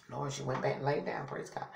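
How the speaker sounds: low-volume private thanks; muttered aside. Real, not acted. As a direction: say it under your breath, off to yourself. Relieved aside about the child.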